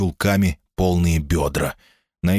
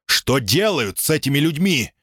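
higher, outraged demand; furious outburst; 7 semitones higher than usual. A narrator's reading. Old man furious at police.

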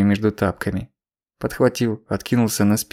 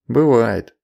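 plain reading voice

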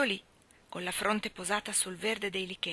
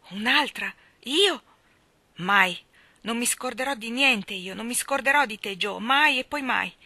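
loud, passionate refusal; passionate vow; 3 semitones higher, 9 dB louder than usual. A narrator's reading. Laurie vowing to Jo he'll never love another: loud, passionate. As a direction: swear it with all your heart.

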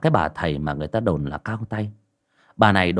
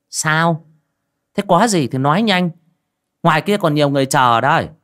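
put-on high, loud and sour old sorceress; sour, tart voice; 7 semitones higher, 4 dB louder than usual. A narrator's reading. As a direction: snap it out impatiently, louder and higher. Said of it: "mà cất giọng chua chát". (giọng chua chát, cao và to)

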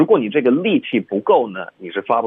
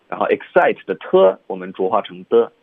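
usual speaking voice

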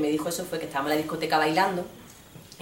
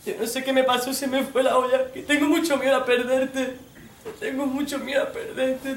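higher, whiny begging; pleading boyfriend; 6 semitones higher than usual. Retold or imitated. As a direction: beg forgiveness pitifully. Boyfriend begs forgiveness.

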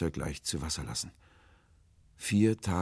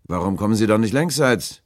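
loud, raised, gruff demand; somewhat gruff; 6 semitones higher, 13 dB louder than usual. A narrator's reading. Sailor questions the unseen stranger, loud and gruff. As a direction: call out impatiently, pitched up. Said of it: "fragte ich etwas unwirsch".

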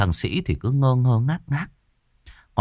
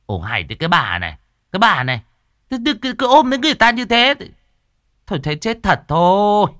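irritated exclaim; 11 semitones higher, 5 dB louder, faster than usual. A narrator's reading. Cô An annoyed at clingy woman.

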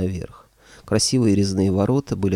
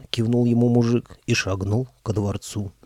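ordinary delivery, nothing marked

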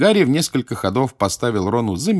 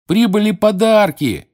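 excited shout; 13 semitones higher, 7 dB louder than usual. Acted. Seamus excited.

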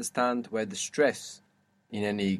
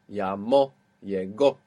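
llamó on MÓ, llegó on GÓ, pitched lower; final-syllable stress; 3 semitones lower than usual. Shown as a demonstration. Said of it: "are gonna have that accent".

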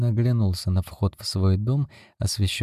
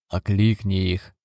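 plain reading voice

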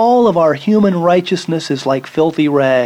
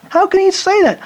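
much higher, astonished exclamation; incredulous. Real, not acted. Preacher reacting to Saul's contradiction, high incredulous exclamation. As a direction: sound truly unable to believe it.

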